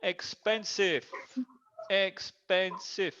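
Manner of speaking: "Expensive" slowly, in three beats, ex-pen-sive; syllable by syllable. Shown as a demonstration. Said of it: "tres sílabas".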